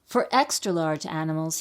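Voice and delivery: ordinary delivery, nothing marked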